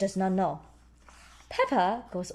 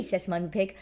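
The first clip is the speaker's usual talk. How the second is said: usual speaking voice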